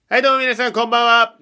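high, loud DJ opening; radio host greeting. Acted. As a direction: greet listeners like a late-night DJ. (ラジオDJの挨拶)

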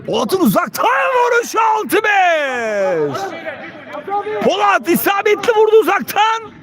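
high, stretched-out cry of the score; excited goal call. Real, not acted. Commentator announcing Polat's long-range goal, excited shout.

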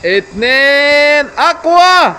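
loud drawn-out cheer; cheering shout. Real, not acted. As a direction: shout encouragement up high. Dad cheering on a ride.

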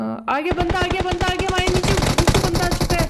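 high, loud, rushed callout; urgent warning. Real, not acted. Player warning teammate Goku an enemy is here, urgent warning.

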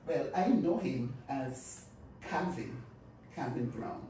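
ordinary talk

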